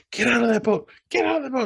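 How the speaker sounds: high, shouted mock panic; mock panicked yell. Dad yelling at his son in the boat, high mock panic. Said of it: "he's like".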